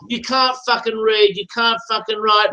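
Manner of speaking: ordinary talk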